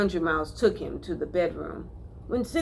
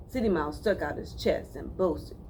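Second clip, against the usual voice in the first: ordinary delivery, nothing marked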